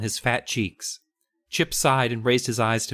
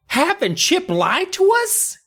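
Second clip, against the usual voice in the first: much higher, slow and disbelieving; shocked high question; 3 dB louder, slower than usual. Retold or imitated. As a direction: Joe realising he was tricked. Joe shocked.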